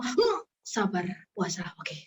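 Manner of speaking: quietly reminding herself to stay patient while fasting; self-calming murmur. Retold or imitated. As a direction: talk yourself down, fading quietly.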